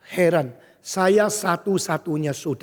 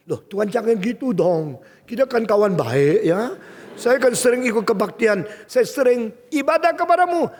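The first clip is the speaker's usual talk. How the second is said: wheedling complaint to God, growing higher and louder; acted indignant pleading; pleading protest; 5 semitones higher, 4 dB louder than usual. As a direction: argue like a hurt friend, building in pitch.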